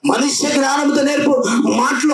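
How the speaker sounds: high, ringing preacher's cry; full-force preaching. Acted. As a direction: preach it out at the top of the voice.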